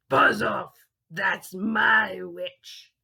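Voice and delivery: loud, very slow beastly threat; monster growl. Acted. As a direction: bellow it like a horrible beast. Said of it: "a yowl and a growl".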